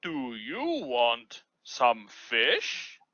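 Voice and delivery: slow questioning puppet voice; Uni puppet voice. Pretend. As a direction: ask slowly like Uni the puppet. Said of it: "Uni asks Sophia".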